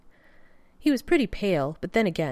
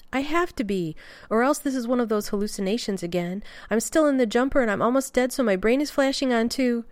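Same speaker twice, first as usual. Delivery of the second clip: frantic insistence; 7 semitones higher, 4 dB louder than usual. Acted. Rodney insists he is hallucinating.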